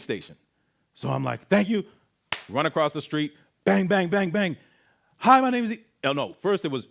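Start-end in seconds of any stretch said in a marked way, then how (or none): 1.5-1.8 hurried thanks. Retold or imitated. Hurried thanks while fleeing. 3.6-4.6 loud high bangs on a door; imitated door banging. 5.2-5.8 high, loud frantic greeting; frantic start. Retold or imitated. Panicked plea at police door.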